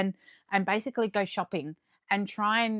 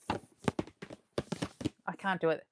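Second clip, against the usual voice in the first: slow ASMR whisper that breaks off; slow whisper for ASMR that she abandons; attempted ASMR whisper; 3 semitones lower, 8 dB quieter, much slower than usual. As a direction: try a slow ASMR whisper, then give up. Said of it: "A S M R".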